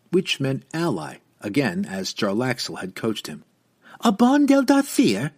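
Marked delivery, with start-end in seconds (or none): 4.0-5.3 high, loud outraged cry. Acted. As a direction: shriek it back at him. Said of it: "she cried back".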